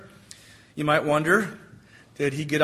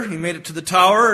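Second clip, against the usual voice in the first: usual speaking voice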